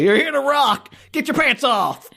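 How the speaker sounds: high-pitched hammed-up concert shout; mock rock frontman. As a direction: hype the crowd like a band singer. Playing the imagined band's frontman.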